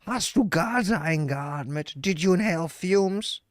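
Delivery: slow, drawn-out mock of a scolding German woman; imitating the German woman. Mocking the girlfriend's scolding line to the viewers, slow and drawn out. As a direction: savor each word like her nagging. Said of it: "I fucking love how she says that".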